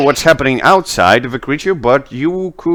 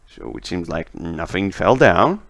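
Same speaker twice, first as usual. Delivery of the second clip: ordinary delivery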